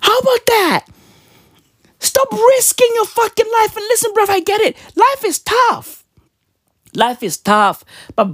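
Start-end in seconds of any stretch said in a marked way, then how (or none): 0.0-4.8 much higher, preachy put-on voice; high-pitched lecture to illegal miners; high mock scolding. Scolding zama zamas in mock voice. 4.9-6.0 much higher and slow; mock-sigh it up high; high-pitched sarcastic consolation; high slow mock sympathy. Mock voice then plain repeat.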